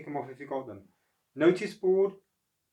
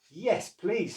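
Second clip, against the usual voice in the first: grateful; 7 semitones higher, 3 dB louder than usual. Acted. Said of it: "said Oscar".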